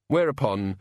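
ordinary delivery, nothing marked

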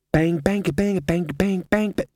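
high rhythmic bang-bang beat; imitated banging. A narrator's reading. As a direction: chant the bangs like a pounding rhythm. Imitates the sound he wants.

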